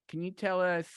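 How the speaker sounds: ordinary talk, nothing marked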